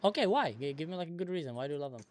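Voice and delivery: usual speaking voice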